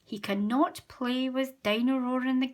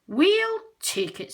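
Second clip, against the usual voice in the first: Daddy Pig; 10 semitones higher, 8 dB louder, slower than usual. A narrator's reading. Daddy Pig voiced in story.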